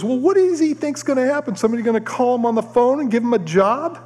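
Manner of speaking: high-pitched scornful questioning, imitated; mock angry mother-in-law. Mother-in-law mad at her daughter, high mock indignation. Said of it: "she's getting mad at Bobby".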